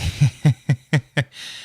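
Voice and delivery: high staccato giggle; laughing. Real, not acted. Host cracking up at a fighter's name, real laughter. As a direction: break into quick, high laughter.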